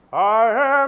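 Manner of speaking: booming I am sung from the diaphragm; powerful I am. Shown as a demonstration. Host showing how the singer belts the line, booming. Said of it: "he sing them from the diaphragm".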